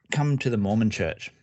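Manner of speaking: ordinary delivery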